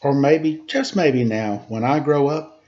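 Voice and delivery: lively rhymed storybook reading; reading a story to children. A narrator's reading. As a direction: read the rhyming story aloud to the kids. Reads a rhymed picture book aloud.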